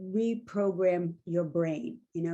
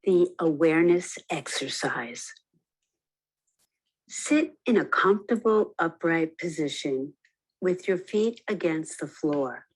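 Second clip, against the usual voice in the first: guided meditation; 4 dB louder than usual. Acted. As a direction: lead them into stillness, unhurried. Leads a guided awareness exercise.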